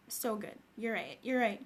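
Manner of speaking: normal talking voice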